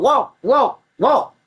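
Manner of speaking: high, loud rising orders; mock cop shout. Cop dragging him and yelling walk, mock shout. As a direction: bark walk over and over. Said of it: "talking about".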